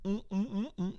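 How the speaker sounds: repeated soft, high "mm"s; mime's hum. Retold or imitated. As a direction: imitate a mute mime pointing with little hums. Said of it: "doing his whole fucking mime shit".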